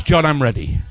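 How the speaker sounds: loud call, voiced for the volunteer; mock shout. Shown as a demonstration. Magician imitating his volunteer's cue, loud mock shout. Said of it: "and she shouts".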